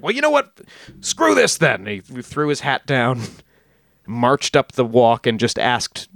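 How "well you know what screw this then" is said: mock huffy quitting outburst, much higher. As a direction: toss off an indignant "screw this". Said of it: "he was like".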